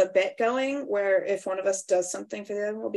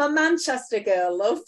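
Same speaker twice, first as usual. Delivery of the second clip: Manchester accent; 3 semitones higher, 3 dB louder than usual. Shown as a demonstration. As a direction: play a chatty Manchester girl. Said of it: "from where I'm sitting is".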